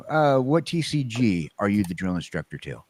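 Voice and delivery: ordinary delivery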